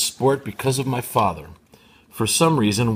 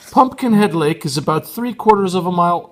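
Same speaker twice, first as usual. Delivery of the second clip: raised, louder read of the narration in the mother's voice; slipped into the mother's voice; mother's voice by mistake; 9 semitones higher, 7 dB louder than usual. Acted. Reading narration, wrongly done in the mother's voice. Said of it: "that wasn't the mother".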